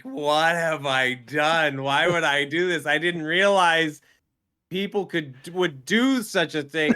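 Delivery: loud, high, drawn-out lament; distressed regret. Retold or imitated. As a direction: wail it in comic disbelief. Retelling own regret mid-punishing workout.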